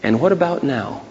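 soft, quiet probing question; hushed divine prompting; little inner voice. Acted. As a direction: pose it gently, like a voice in the head. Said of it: "the little voice said to me".

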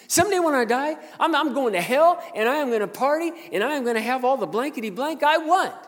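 high, booming bragging yell; imitating boastful coworker. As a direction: crow it loudly as the rowdy mechanic. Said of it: "this is what he did".

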